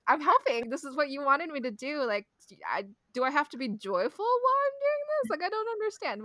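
high-pitched, worked-up retold protest; exasperated bewilderment. Retold argument with mom, escalating.

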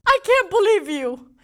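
high, loud accusation breaking into laughter; mock outrage. Wife calling husband about missed anniversary, laughing mock outrage. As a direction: scold him while laughing. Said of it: "I'm like".

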